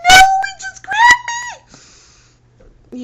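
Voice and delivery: very high, loud sobbing panic; high pitched crying. Retold or imitated. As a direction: wail it high and tearful, barely getting words out. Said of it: "real high pitched tone and me crying".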